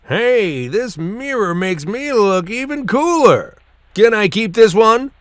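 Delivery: put-on high, loud, show-off kid voice; cocky character voice.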